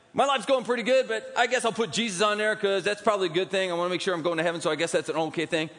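high, breezy careless voice; casual believer. Pretend. Preacher mocking a believer who treats Jesus casually, breezy and high. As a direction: toss it off like an afterthought.

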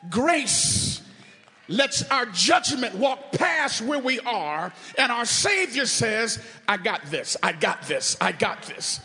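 loud, driving sermon delivery; full-force preaching. Real, not acted. Preacher proclaiming grace to congregation, full-force preaching. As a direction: belt it out to the whole church.

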